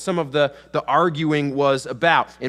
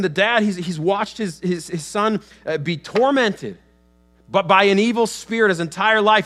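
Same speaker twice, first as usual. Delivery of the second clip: normal talking voice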